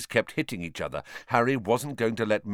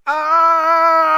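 battle cry; 10 dB louder than usual. Acted. Shout as Harry charges.

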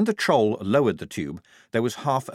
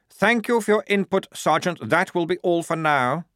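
much higher, prim brush-off; curt dismissal; 9 semitones higher, 4 dB louder than usual. Acted. Lieutenant cutting off the sergeant, prim and much higher. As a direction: shut the sergeant down politely.

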